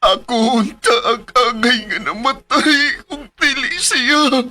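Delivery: loud, sobbing self-blame; crying out in guilt. Acted. At the altar, blaming himself for his friend's death, sobbing. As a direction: break down as you say it.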